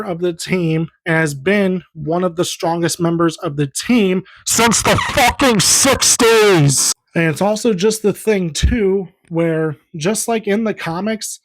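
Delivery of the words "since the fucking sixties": worked-up shout, drawn out and higher; real exasperated holler, stretching each word; loud outburst. Real, not acted. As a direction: yell it out slowly, fired up mid-rant. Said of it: "I apologize for getting loud".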